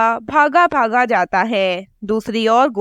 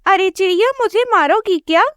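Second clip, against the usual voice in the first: higher, quick, a touch of panic; frightened crow wife; 9 semitones higher, faster than usual. Acted. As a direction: gasp at the raised stick. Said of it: "डर जाती है".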